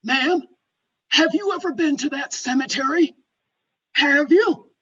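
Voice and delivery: loud, high, pointed questioning; imitated caller, emphatic and insistent; challenging gentleman. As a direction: press her with a loud challenge. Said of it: "And he said".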